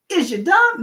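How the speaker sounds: high, loud, incredulous jab; exaggerated disbelief at someone's stupidity; blunt mock insult. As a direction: blurt the rude comeback in jest. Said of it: "but I wanna be like".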